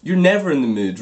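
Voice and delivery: usual speaking voice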